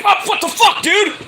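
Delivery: high-pitched mock yelp of shock; shocked outburst. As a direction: re-enact a stunned, indignant squawk. Acting out reaction to being hit.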